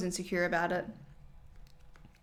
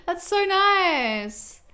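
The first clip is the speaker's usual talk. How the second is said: slow, breathy-high, much higher thank-you; touched thanks; 6 semitones higher, much slower than usual. Real, not acted. Streamer thanks a viewer who cured insomnia, touched and slow. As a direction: let it melt out, moved and grateful.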